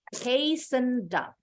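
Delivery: "Hastened up" said slowly as "heisənd-ap", the d joined onto up; teacher drilling the linked d in "Hastened up". Shown as a demonstration. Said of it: "kita sambung akhiran bunyi D-nya".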